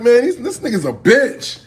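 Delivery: high, loud indignant outburst; mad complaint. Retold or imitated. Crew mad at the opponent, loud indignant complaint. Acts group's angry complaint.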